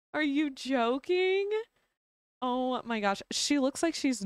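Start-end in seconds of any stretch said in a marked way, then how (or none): none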